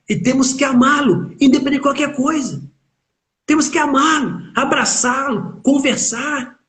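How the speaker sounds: high-pitched fervent exhortation; fervent urging. Acted. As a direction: lift the voice and plead. Urging parents to love children.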